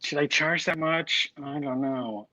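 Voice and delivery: a breathy whisper of second-guessing; whispered doubt. Retold or imitated. Asking herself in a hushed whisper whether to charge. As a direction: whisper it like a worry you barely admit.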